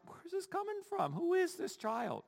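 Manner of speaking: high, hushed mock bewilderment; squeaky-soft amazed questioning; bewildered. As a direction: wonder softly in a high, baffled voice. Acted bewilderment at her sudden boldness.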